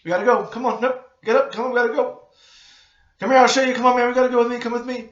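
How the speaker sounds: loud, high, urgent commands; blunt and direct. Shown as a demonstration. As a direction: order someone to move right now, insistently. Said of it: "you have to be that blunt and direct".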